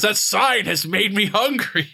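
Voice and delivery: Conan voice. Retold or imitated. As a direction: play the dim, hungry barbarian. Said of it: "and Conan goes".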